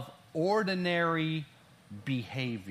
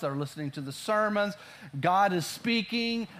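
usual speaking voice

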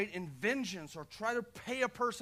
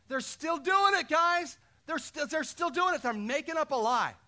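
high, loud outburst to the crowd; excited exclaiming; 9 semitones higher, 7 dB louder than usual. Real, not acted. Preacher exclaiming to the congregation about the lie, high and loud. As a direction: cry it out in disbelief. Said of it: "preacher exclaims to congregation".

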